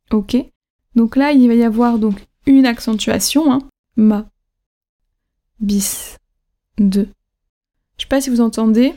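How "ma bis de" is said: "ma", "bis", "de" each low and descending; isolated words, each let drop at the end; neutral, dropping MA, BIS, DE; falling final accent. Shown as a demonstration. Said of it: "La fin du mot descend".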